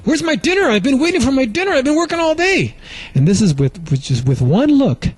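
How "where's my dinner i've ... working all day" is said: mock angry husband. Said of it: "finally you get really mad".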